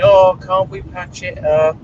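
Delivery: high, slow whiny plea; mock the pleading ex-friend; high drawn-out mimicry of a pleading acquaintance. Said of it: "they were going".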